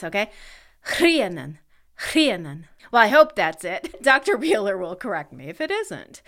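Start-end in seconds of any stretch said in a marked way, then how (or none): 1.0-1.6 "Rhiannon" with a rough, breath-pushed Welsh RH; authentic Welsh pronunciation. Shown as a demonstration. Host attempting Welsh for her sister, careful Welsh Rhiannon. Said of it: "authentic Welsh pronunciation of Rhiannon". 2.1-2.7 "Rhiannon" with a rough, breath-pushed Welsh RH; authentic Welsh pronunciation. Shown as a demonstration. Host trying it a second time, careful Welsh Rhiannon. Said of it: "authentic Welsh pronunciation of Rhiannon".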